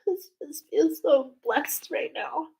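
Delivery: high, halting voice through tears; grateful and choked up; tearfully moved. Real, not acted. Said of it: "It's a beautiful cry".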